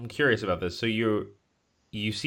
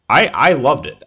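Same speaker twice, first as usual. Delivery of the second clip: excited; 5 semitones higher, 11 dB louder than usual. Real, not acted. Enthusing about the movie.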